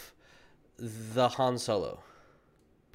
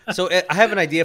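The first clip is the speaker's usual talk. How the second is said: laughing; 6 semitones higher, 6 dB louder, faster than usual. Real, not acted. Laughing while teasing.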